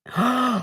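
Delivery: sharp horrified gasp; shocked gasp. Real, not acted. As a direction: suck in a sudden shocked breath. Realizes the mic was live.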